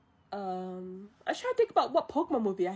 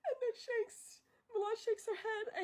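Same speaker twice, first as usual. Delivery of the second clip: high, soft, through giggles; laughing; 13 semitones higher, 10 dB quieter than usual. Real, not acted. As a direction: say it while still giggling. Still laughing through narration.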